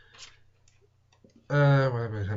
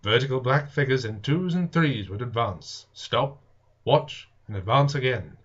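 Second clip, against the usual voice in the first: steady, raised reading voice; story narration; 5 semitones higher, 7 dB louder than usual. A narrator's reading. Reader narrating War of the Worlds to viewers, storytelling voice. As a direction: tell the tale to the listeners, evenly. Reads the novel aloud.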